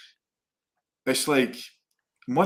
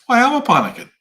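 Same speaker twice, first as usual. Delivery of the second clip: higher and louder, a half-laughing realisation; excited self-question; 12 semitones higher, 11 dB louder than usual. Retold or imitated. Retelling his own thought to the co-host, higher and louder. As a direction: burst out with the question as it dawns on you.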